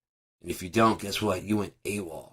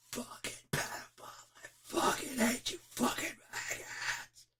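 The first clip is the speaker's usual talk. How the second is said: hushed screaming; 10 dB quieter than usual. Shown as a demonstration. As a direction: scream it under your breath. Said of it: "I've been screaming".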